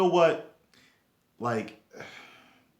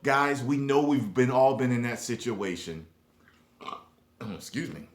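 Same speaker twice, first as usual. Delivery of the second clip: ordinary talk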